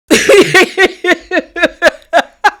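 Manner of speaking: high-pitched laughing fit. Real, not acted. Host laughing at her El Chapo joke, high laugh. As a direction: crack up at your own joke.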